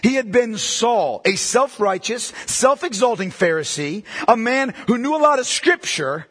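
fervent pulpit preaching. Real, not acted. Preacher delivers sermon at full force.

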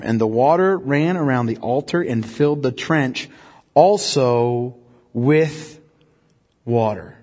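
measured, deliberate Bible reading; solemn scripture reading. A narrator's reading. Reads scripture passage aloud.

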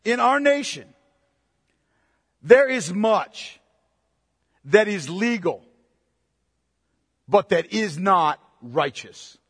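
spelled out slowly with long pauses; measured phrases, each left to sink in; slow, deliberate. Real, not acted. Preacher spelling it out for those who struggle to understand, slow and deliberate. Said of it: "so I'll say it slowly".

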